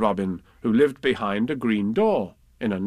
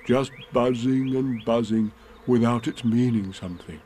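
lightly voiced pondering bear. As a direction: puzzle it out as a thoughtful bear.

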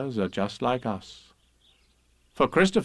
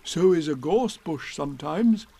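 Pooh's reply; 4 semitones higher than usual. A narrator's reading. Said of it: "said Pooh".

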